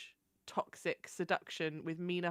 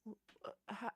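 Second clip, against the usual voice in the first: normal talking voice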